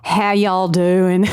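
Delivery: slow, put-on southern drawl; mock southern accent, stretched out; joke southern accent. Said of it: "I was doing a southern accent".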